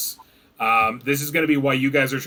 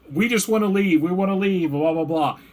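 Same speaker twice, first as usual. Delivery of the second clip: high-pitched imitation of begging; hammed-up plea to get out; mock pleading; 5 semitones higher than usual. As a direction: plead in a higher voice, dismissively. Game master mimics player's plea to leave.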